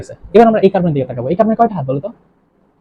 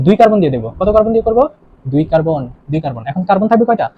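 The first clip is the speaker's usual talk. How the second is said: ordinary delivery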